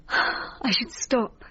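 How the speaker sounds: sigh, then whisper it very slowly; drowsy whispered answer. Acted. Patient under hypnosis answering the therapist, sighing whisper.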